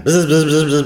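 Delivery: loud buzzing imitation; buzzer noise.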